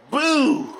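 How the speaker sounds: jump scare. Retold or imitated. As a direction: leap out and yell it to scare the dog. Scaring the sleeping dog.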